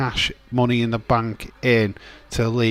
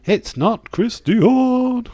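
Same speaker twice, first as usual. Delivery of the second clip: much higher, slow, louder drawl; mocking squeaky voice; 13 semitones higher, 6 dB louder, much slower than usual. Streamer teasing chat's wrong guess in a squeaky voice.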